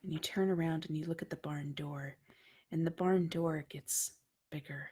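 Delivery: dark dream narration; hushed horror narration. A narrator's reading. GM narrating a creepy dream scene.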